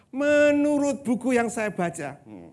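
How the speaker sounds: loud, high-pitched mock lecturer. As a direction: parody a lecturer insistently quoting his books. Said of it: "Ada seorang dosen".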